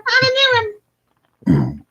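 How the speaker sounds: "I'm a new one" very high, slow and squeaky; slow squeaky demonic puppet announcing itself; Satan Elmo voice. Shown as a demonstration. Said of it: "I sound like Satan Elmo".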